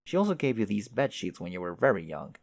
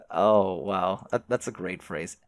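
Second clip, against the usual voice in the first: usual speaking voice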